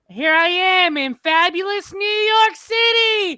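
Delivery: high, drawn-out mock tourist holler; very loud. Imitating a lady visiting New York, loud high holler. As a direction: belt it out slowly at the top of your voice. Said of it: "So loud".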